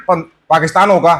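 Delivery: ordinary delivery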